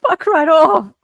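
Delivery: a hammed-up shout between laughs; mock outburst. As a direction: laugh then yell them off. Acting out telling Lauren off.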